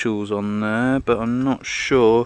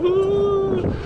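excited whoop. Real, not acted. Cheering at the new field.

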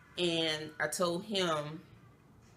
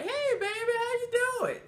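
dad's casual greeting, pitched way up; nonchalant dad; 3 dB louder than usual. Retold or imitated. Dad phoning his rescued daughter, nonchalant but high-pitched. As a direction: greet your daughter like nothing is wrong, high voice. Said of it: "just so nonchalant or whatever".

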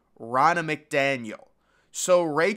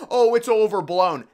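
dismissive mockery; 6 semitones higher, 6 dB louder than usual. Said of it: "So they just like".

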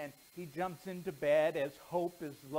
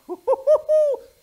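put-on high, loud cackling laugh of a naughty boy; gleeful laugh; 20 dB louder than usual. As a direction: laugh like a kid who got away with it. Boy gloats over buried doll.

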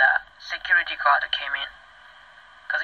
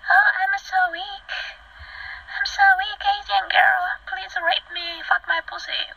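high, sing-song whimper of feigned weakness; exaggerated high-pitched damsel voice; mock helpless victim; 14 semitones higher than usual. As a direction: sarcastically play weak in a tiny high voice. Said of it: "Am I gonna say that".

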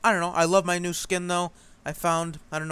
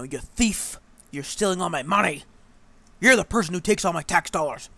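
imitating an angry taxpayer, louder and higher; hammed-up furious rant, building louder; mock angry yelling; 4 semitones higher, 8 dB louder than usual. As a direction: bellow the accusations like a mock-outraged commenter. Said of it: "he was pretty angry at me".

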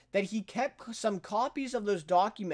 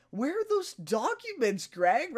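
higher, slow, mock-friendly question; imitating Tom; 7 semitones higher, slower than usual. Tom coaxing Greg for the documents, higher and slow mock-friendly. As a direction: coax it out of him like it's nothing. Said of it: "then he says".

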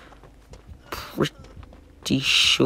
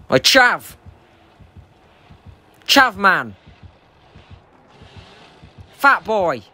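high, loud heckling calls; taunting shout; 7 semitones higher, 4 dB louder, much slower than usual. Pretend. Player goading the killer to chase him, taunting shout. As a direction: holler short taunts across a distance.

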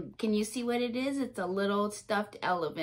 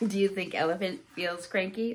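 plain reading voice

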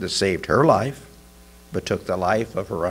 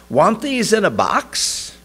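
shop clerk voice; 9 semitones higher than usual. A narrator's reading. Clerk asks customer.

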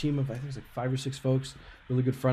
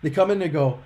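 normal talking voice

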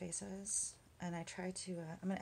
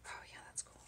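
whispered; 11 dB quieter than usual. Real, not acted. Whispered approval to herself.

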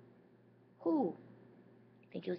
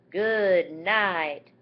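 much louder armadillo; 10 dB louder than usual. Acted. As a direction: call it like a proud armadillo. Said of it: "The armadillo says".

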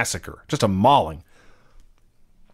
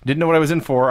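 normal talking voice

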